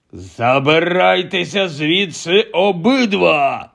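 slow, rasped order; hoarse. Acted. Uncle rasping at both boys to get out, hoarse. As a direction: croak them out of the room. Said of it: "прохрипів дядько Вернон".

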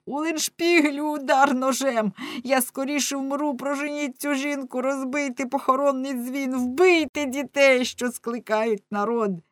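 high, wailing plea; desperate crying out. A narrator's reading. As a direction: beg for death in an agonised high voice. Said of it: "кричав рибник".

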